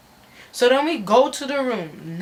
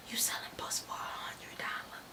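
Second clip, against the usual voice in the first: scandalised stage whisper; hushed retold scolding; whispered disbelief; 20 dB quieter than usual. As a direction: whisper it, appalled at the price. Scandalised aside to friend, retold.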